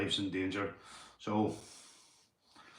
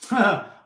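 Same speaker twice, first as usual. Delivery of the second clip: burst of laughter; 8 semitones higher, 8 dB louder than usual. Real, not acted. Laughs at chat joke.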